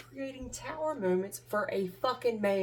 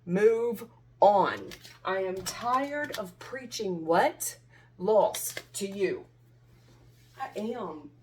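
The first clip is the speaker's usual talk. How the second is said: loud, emphatic real exasperation; fed up; 8 dB louder than usual. Real, not acted. Tarot reader tired of preaching to someone, fed up. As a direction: punch the words out, sick of repeating them. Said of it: "I am so sick of it".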